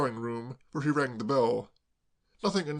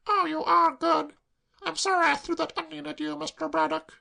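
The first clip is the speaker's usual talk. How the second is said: put-on tearful girl; 3 dB louder than usual. Claire sobbing to Braddock, high tearful wail.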